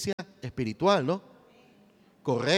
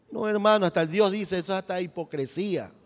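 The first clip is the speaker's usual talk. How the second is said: ordinary talk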